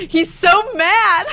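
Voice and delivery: slow, high, cracking laugh-shout. Real, not acted. Friend cracking up at the angry teen. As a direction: howl it through laughter.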